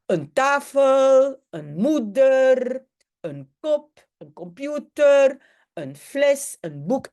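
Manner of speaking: chaque « een » lentement détaché, en démonstration; articles néerlandais lents. Shown as a demonstration. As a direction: prononcer « een tafel, een moeder » lentement, comme une leçon. (articles néerlandais dits lentement)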